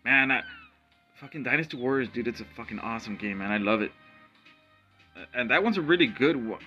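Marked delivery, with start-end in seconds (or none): none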